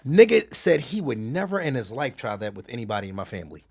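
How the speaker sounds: usual speaking voice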